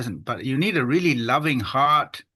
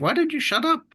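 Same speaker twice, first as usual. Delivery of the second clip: sharply raised mock exasperation; annoyed retort. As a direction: fire back like an irritated listener. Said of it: "And people think".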